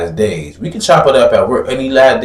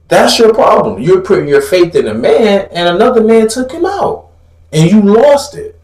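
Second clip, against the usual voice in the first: ordinary delivery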